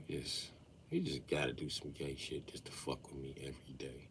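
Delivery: low, soft grumbling under breath; annoyed dad muttering about son; muttered grumble. Retold or imitated. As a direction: grumble it to yourself. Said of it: "I be like".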